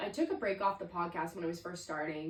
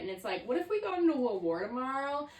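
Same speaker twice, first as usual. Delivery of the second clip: ordinary talk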